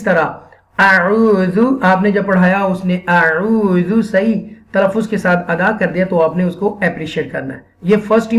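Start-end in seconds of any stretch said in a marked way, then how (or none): none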